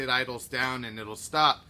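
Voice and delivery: usual speaking voice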